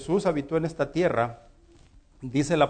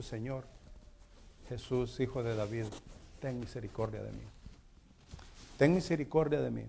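much softer, lower, pleading prayer; quiet plea; 4 semitones lower, 11 dB quieter than usual. Retold or imitated. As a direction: murmur it like a private prayer for mercy. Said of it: "yo he dicho". (súplica suave y baja, como oración íntima)

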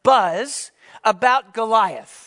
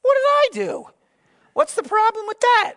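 much higher, louder whiny protest voice; innocent kid brother; 5 dB louder than usual. Acted. David protesting to his angry brother, high whiny innocence. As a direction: play the wounded innocent. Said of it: "And David's like".

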